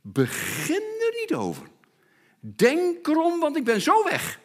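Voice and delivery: hoog, spottend afwijzend; spottend nagedaan. Retold or imitated. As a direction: doe hem na en wuif het weg. (spottend hoog nagedaan afwijzen)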